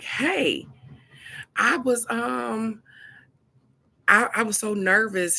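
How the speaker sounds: a hint of a gentle, timid cousin; polite, kind voice. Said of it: "very polite, kind voice".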